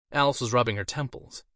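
ordinary delivery

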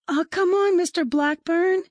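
put-on high-pitched children's voices; pleading kids. A narrator's reading. Pupils urging the librarian to read, high and pleading. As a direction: beg in a high, eager child's voice.

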